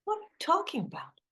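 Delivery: quick, high startled question; surprised. Acted. Friend claims to have found her thermos, fast surprised question. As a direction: fire it off fast in disbelief.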